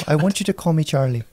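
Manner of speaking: mock Irish lilt, put on for laughs; Irish accent. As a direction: play it in a cheeky Irish accent. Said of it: "No, that's Irish".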